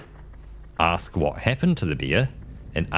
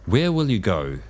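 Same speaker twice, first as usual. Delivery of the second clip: ordinary talk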